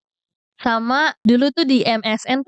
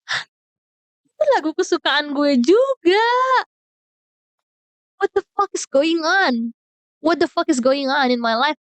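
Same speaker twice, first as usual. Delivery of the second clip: higher, incredulous exclamation; shocked disbelief; 5 semitones higher than usual. Retold or imitated. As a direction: react like you can't believe it. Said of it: "Gue kayak". (tak percaya, suara naik tinggi)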